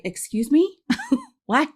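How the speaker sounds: high, rising incredulous question; stunned disbelief. Retold or imitated. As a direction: act out being taken aback. Acts out her stunned reaction.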